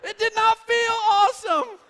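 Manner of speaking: high, loud, half-laughing protest; dad yelling that it was no fun; indignant shout. Retold or imitated. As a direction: shout back in mock outrage. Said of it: "I'm like".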